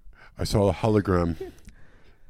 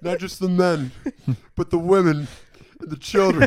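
dramatic Anakin parody; 8 semitones higher, 3 dB louder than usual. Pretend. Anakin speech, laughing.

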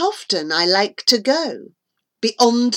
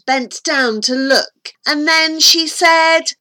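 usual speaking voice

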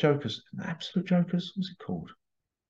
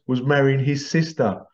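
normal talking voice

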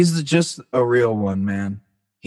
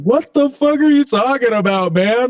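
high, incredulous and much louder, like he's lost the plot; baffled outburst; 12 semitones higher, 4 dB louder than usual. Retold or imitated. Telling Trev his mountain advice makes no sense, shouted in high disbelief. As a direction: squawk it back at a friend who just spoke in riddles. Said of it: "I was like".

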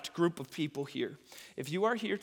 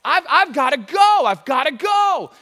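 urgent shout; 10 semitones higher, 14 dB louder than usual. Retold or imitated. Voiced inner urge, shouted.

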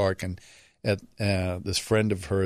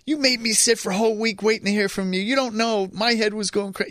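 put-on higher, fast, scolding girlfriend; mock scolding; 12 semitones higher than usual. His date scolds him for not calling all week, higher rapid mock scolding. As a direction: rattle off her complaint in a higher voice. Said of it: "And she goes".

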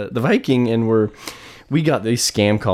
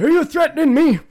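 much higher, louder mock indignation; mock outrage; 3 dB louder, faster than usual. Telling off the scam caller, mock outrage. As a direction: fake offence at the caller.

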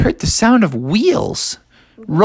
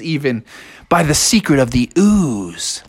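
usual speaking voice

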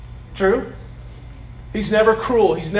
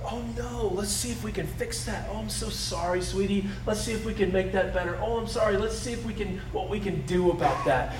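put-on much softer, gentle soothing of a crying child; tender parent comforting; 7 dB quieter than usual. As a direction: coo to a sweetie with a broken toy. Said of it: "the kind and loving parent said".

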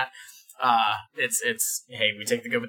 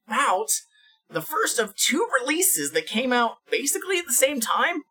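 announcer voice; 12 semitones higher than usual. Acted. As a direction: announce it like a grand reveal. Hammed-up announcing the topic.

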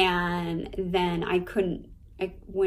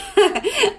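bursting into a high laugh; a sudden loud laugh; 14 semitones higher, 9 dB louder than usual. Real, not acted. Laughing at own joke.

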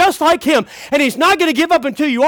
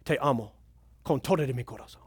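low, hushed Spanish love vow; tender declaration; 12 semitones lower, 13 dB quieter, faster than usual. Acted. Preacher wooing pretend Spanish girlfriend, tender. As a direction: murmur it softly as a lover. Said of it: "I say to my Spanish gir".

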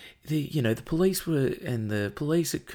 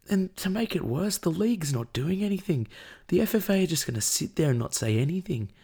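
ordinary delivery

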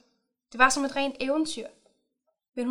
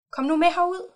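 higher, louder call across the ice; 6 semitones higher, 6 dB louder than usual. A narrator's reading. He calls to her from far out on the ice, loud and higher.

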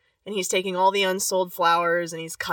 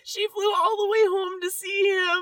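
higher, choked-up crying; 10 semitones higher than usual. Real, not acted. Host recapping the reunion, crying for real. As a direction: fight through real tears. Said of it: "You legit crying up in here".

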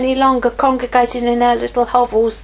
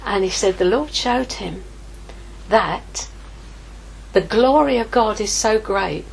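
normal talking voice

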